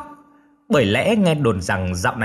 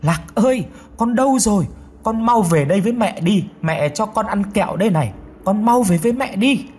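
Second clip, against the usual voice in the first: desperate calling; 7 semitones higher than usual. A narrator's reading. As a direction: call the lost child home, pleading. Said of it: "mà gào lên".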